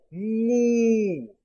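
mou, nasal from the throat, held long; nasal syllable mou. Shown as a demonstration. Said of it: "Son nasal qui vient de la gorge".

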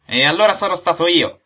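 loud, high sarcastic comeback; high-pitched, heated objection; indignant retort. Acted. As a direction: throw it back hotly. Acted quarrel, sarcastic retort.

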